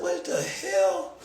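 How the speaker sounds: slow disbelief. Retold or imitated. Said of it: "I said".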